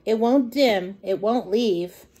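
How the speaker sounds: usual speaking voice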